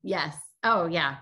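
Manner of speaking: normal talking voice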